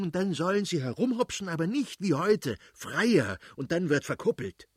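high-pitched, scornful tirade; acted irate father's rant; ranting angrily. A narrator's reading. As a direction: rail on in exasperated indignation. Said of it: "brauste der Fürst plötzlich auf und schrie".

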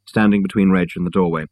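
ordinary delivery, nothing marked

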